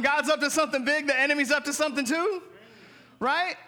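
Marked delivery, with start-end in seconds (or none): none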